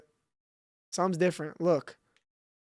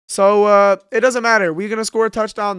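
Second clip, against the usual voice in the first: excited; 3 semitones higher, 9 dB louder than usual. Real, not acted. Excited outburst at touchdown.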